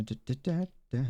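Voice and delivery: ordinary delivery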